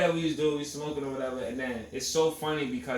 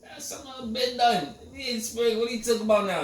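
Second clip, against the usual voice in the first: mock confused self; 12 semitones higher, 5 dB louder than usual. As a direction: play your younger self baffled, high. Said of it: "I'm like".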